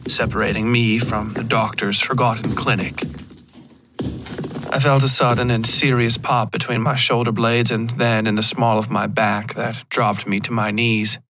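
usual speaking voice

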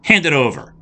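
high, sassy demand. Acted. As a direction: toss it off cheekily. Andrea demands the mirror.

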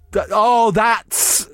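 usual speaking voice

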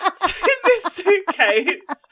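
high, loud, slow words through real laughter; laughing helplessly. Real, not acted. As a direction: try to get it out while cracking up. Cracks up describing the suitcase contents.

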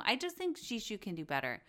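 usual speaking voice

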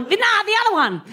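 a high, sharp Oi! thrown across the house; working-class mum shout. Retold or imitated. Mum yelling at her kids, high working-class call. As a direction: bark it like a tired mum calling the kids. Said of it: "she was working class".

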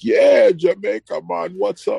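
loud, high-pitched imitation Jamaican greeting; fake Jamaican accent. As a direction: mimic a cashier's over-the-top Jamaican accent. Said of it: "trying to do your fake Jamaican accent".

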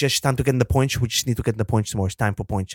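rushed, slurred mumble; Bottas impression. Imitating Bottas talking about points, slurred mumble. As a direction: run the words together as a mumbling driver. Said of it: "And then he goes".

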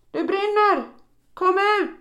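very high, loud shout of fire; roaring warning. Acted. Narrator shouting up at the windows, very high and loud. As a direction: yell up at the windows with all you have. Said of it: "vrålar allt jag kan".

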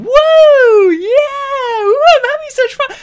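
hammed-up delight, squealing woo; mock wild cheering.

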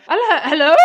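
loud, high phone greeting; old lady on phone. Retold or imitated. A caller on her nan's landline greets loudly and high. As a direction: call hello down the line. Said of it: "lovely old lady who went".

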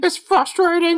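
very high parroting falsetto; Popo. Acted. Host as Popo echoing Fink's complaint, high falsetto. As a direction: squeal it like a mocking puppet. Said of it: "Popo's like".

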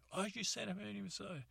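high, fast, soft retort; high quick father voice. Retold or imitated. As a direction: toss it back like an offended dad. Father reacts to apology.

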